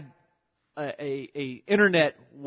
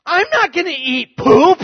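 much higher, louder revolted protest; the son refusing outright, higher and louder; disgusted refusal; 13 semitones higher, 8 dB louder than usual. Retold or imitated. Son refusing to eat poop.

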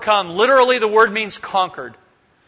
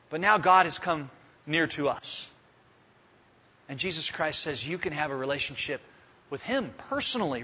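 hushed and tender; 4 semitones lower, 7 dB quieter than usual. Real, not acted. Preacher to congregation on God's nearness, hushed and tender. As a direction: drop to a soft, intimate hush.

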